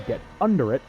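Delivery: usual speaking voice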